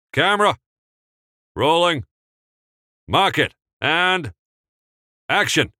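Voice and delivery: loud high crisp cues; set calls. Acted. Director and crew calling cues for the take, loud. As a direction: call each cue out.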